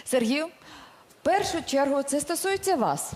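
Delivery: ordinary delivery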